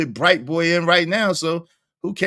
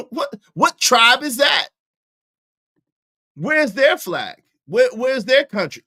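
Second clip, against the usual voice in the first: mocking shout; 8 semitones higher than usual. Mocking claim again, shouting.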